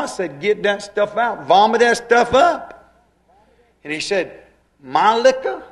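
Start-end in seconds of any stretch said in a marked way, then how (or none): 0.4-2.9 rising to a loud, high yell; shouting at grandpa. Retold or imitated. Preacher yells at drunk grandpa. 4.8-5.6 high, louder outraged yelp; indignant old drunk. Retold or imitated. Grandpa protests his liquor.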